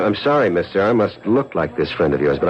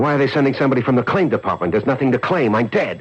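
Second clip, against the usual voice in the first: quick, higher, rattled pitch; alarmed outburst; 7 semitones higher than usual. Acted. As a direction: fire it off fast in alarm. Man alarmed by insurance delay.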